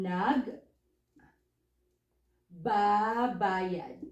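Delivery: "nag", long pause, then slow "ba-bayad"; slow, broken-up demo of nag babayad; syllable by syllable. Shown as a demonstration. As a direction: split nag from babayad and stretch the ba. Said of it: "So it will become".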